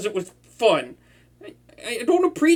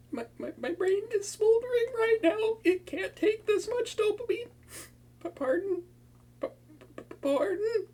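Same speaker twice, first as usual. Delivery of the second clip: higher and softer, stammering; flustered; 11 semitones higher, 7 dB quieter than usual. Acted. Villain told the hero likes him, soft high flustered stammer. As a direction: melt under the confession.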